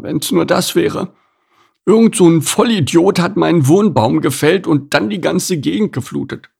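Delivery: high, put-on grumbling animal voice; grumpy opossum, indignant. Opossum complains about losing his home tree, indignant.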